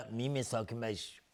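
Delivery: ordinary talk, nothing marked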